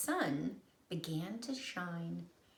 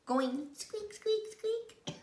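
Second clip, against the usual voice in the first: squeaky guess; slower than usual. A narrator's reading. Imitating squeak.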